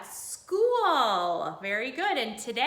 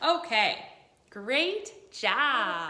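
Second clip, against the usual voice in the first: ordinary talk, nothing marked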